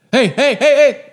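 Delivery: loud high calls of hey; guy calling out. Retold or imitated. Stranger yelling to warn a dad at the park. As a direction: shout it across the park to get attention. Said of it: "some guy going like".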